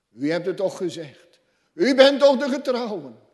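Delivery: building to a high, loud cry; impassioned pleading. Acted. Church pleading with God, building to a loud cry.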